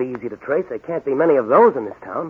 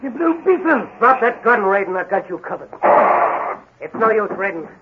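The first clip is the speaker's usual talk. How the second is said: raised, high, forceful challenge; commanding hero; 7 semitones higher than usual. Acted. Masked hero orders the gunman to drop his weapon, commanding. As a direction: call him out from behind the gun. Hero confronts armed villain.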